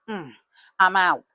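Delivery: loud declaration. Retold or imitated. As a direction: announce you're leaving right now, loudly. Announcing exit to coworkers.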